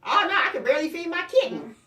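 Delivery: put-on a broke young adult complaining; imitating daughter. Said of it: "she was like".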